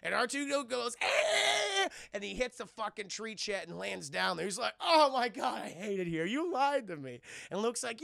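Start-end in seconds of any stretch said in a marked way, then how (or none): 1.0-1.9 high, loud, drawn-out squeal; imitated robot screech; mock droid squeal. Acts out R2 squeal in story. 4.8-7.2 high, loud exclamation, then an accusation; mock wail of grievance; acted outraged whine. Acts R2 complaining to Luke.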